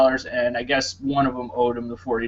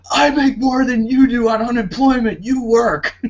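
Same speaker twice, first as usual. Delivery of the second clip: high-pitched mock yell of an angry boyfriend; imitated yelling; 10 semitones higher, 4 dB louder than usual. Imitating neighbor yelling at his girlfriend, high mock yell. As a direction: yell it as if heard through the floor. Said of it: "I hear him yelling".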